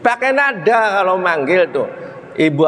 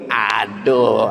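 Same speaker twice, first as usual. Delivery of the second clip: ordinary delivery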